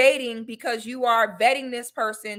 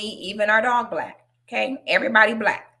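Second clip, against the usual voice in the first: ordinary talk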